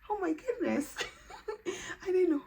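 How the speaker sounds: high, laughing through it; laughing joy. Real, not acted. Still reeling from the result, laughing. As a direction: giggle in disbelief.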